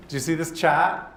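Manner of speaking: chart as "chat", Boston accent, no r; Boston accent, drop the r in "chat". Shown as a demonstration. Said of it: "I almost said chat".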